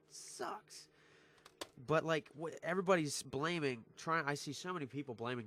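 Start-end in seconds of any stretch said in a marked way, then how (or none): 0.1-0.9 high whispered whimper, softer; softer whiny groan. Real, not acted. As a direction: let it fade into a whimper. Repeats lament softer.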